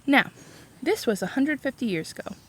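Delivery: ordinary delivery, nothing marked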